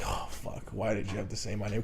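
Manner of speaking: whispered, dropped way down; inner panic. Retold or imitated. Embarrassed employee cursing to himself as his name is said, whispered. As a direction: breathe it out under your breath in dread.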